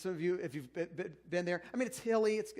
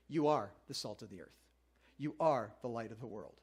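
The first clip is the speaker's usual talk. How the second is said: Jesus' words said much lower and solemn; solemn Jesus; 7 semitones lower than usual. Acted. Pastor reading Jesus to the congregation, low and solemn. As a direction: pronounce it like a calm lord.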